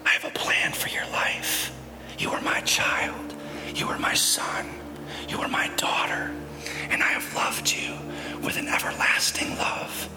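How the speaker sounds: tender unvoiced whisper of God. God speaking to his child, tender whisper. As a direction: murmur it close to the ear. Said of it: "God whispers because he's close".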